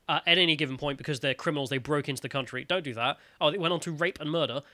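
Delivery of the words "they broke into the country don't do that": normal talking voice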